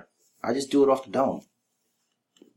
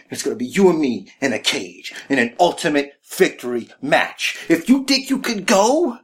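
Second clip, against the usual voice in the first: wrestling promo; 8 semitones higher than usual. Acted. Wrestler cutting a promo on Bray Wyatt, ranting.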